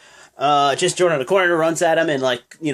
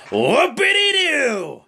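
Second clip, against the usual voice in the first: slow, much higher mock excitement; sarcastic whoopity-doo; sarcastic cheer; 10 semitones higher, slower than usual. Real, not acted. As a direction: fake enthusiasm, dripping sarcasm. Sarcastic about rematch.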